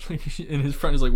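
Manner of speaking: ordinary talk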